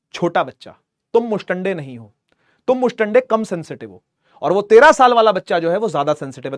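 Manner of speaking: normal talking voice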